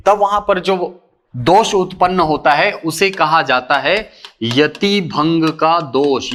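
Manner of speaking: ordinary talk, nothing marked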